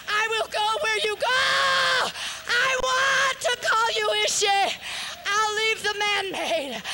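impassioned preacher near tears; preaching at full force. Acted. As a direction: pour it out like a revival sermon.

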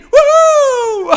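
high gleeful cheer; excited whoop. Acted. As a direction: whoop with joy. Jumping over friend.

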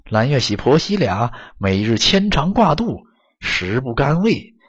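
usual speaking voice

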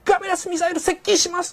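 high, crisp shouted missile report; mock anime alert. Shown as a demonstration. Parodying an anime officer's crisp missile alert. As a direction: bark it out like an anime bridge officer. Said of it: "パッと言えるんではなくて".

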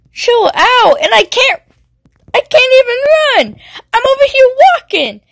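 high, loud complaining rant; exasperated high rant. Real, not acted. Ranting about being slow.

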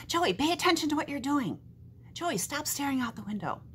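a big brother calling out a daydreamer; brothers yelling. Acted. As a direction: nag him like an annoyed big brother. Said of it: "My big brothers are always yelling at me like that".

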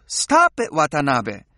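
playful pretend yell; mock yell. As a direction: snap it out, half in fun. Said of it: "I yell at you".

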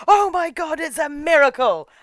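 high, loud cry of wonder; mock amazed exclamation. Priest seeing the weeping statue, mock amazed cry.

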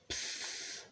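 put-on breathy voiceless psst from the baby; a tiny unvoiced hiss of a word; baby's psst. A narrator's reading. The baby says it to Mog: voiceless psst. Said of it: "It said".

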